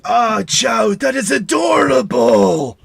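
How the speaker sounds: high, loud, drawn-out gushing; big doting character shout; booming adoration. Acted. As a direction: gush loudly over something adorable. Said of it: "he's like".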